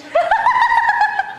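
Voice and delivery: high, loud burst of laughter; laughing burst. Real, not acted. Husband cracking up at his wife, high laugh. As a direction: break up laughing.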